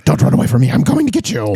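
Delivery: loud growling threat as Batman; Batman shout. Retold or imitated. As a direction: yell it like a deranged Batman. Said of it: "he was like".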